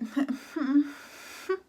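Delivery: a short wet laugh breaking through; tearful high laugh. Real, not acted. Vlogger laughing off tears in front of her viewers. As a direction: laugh it off while fighting tears.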